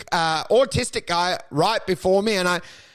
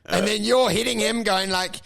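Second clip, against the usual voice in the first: normal talking voice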